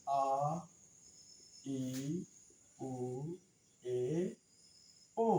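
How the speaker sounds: "আ, ই, উ, এ, ও" as separate pure vowels, long pauses between; pronunciation demo. Shown as a demonstration. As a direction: model "আ, ই, উ, এ, ও" one vowel at a time for learners. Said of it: "আমি একবার বলে দিই". ("আ, ই, উ, এ, ও" একটা একটা করে উচ্চারণ করে দেখানো)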